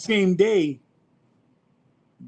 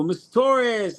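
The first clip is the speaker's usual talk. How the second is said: cop calling his name; 9 semitones higher, much slower than usual. Retold or imitated. Officers' voice retold.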